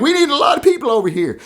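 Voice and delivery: high, fast mock call for backup; mock brave call. Mocking the knocked-down guy calling for help, high. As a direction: bluster like a kid who's suddenly brave. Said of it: "He's like".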